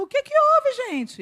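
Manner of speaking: high-pitched puzzled question; retold bewilderment. Retold preacher asks the startled crowd, bewildered. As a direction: ask it baffled, voice jumping up. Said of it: "ele parou e falou".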